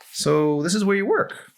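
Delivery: put-on lightly teasing young woman; Emery's teasing voice.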